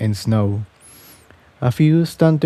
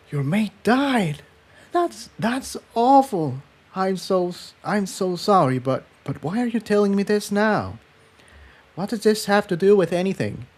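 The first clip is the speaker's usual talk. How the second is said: much higher, softer, flustered; stammering sympathy then confusion; Tiptoe, shocked; 9 semitones higher, 5 dB quieter than usual. Acted.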